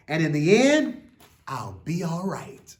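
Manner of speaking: put-on slow, lilting read-aloud for children; an expressive children's book reading; story reading. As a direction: read it like a bedtime picture book. Reads picture book aloud.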